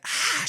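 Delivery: high-pitched "ah" of a lunging attacker; mock attack scream. Retelling the wife charging at her husband, mock shriek. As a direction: imitate a psycho's shriek without much volume. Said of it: "she lurches and she's like".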